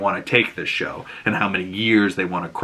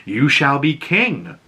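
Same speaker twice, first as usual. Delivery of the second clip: fortune teller prophecy; 2 semitones higher, slower than usual. Retold or imitated. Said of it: "this woman looks at Egg and says".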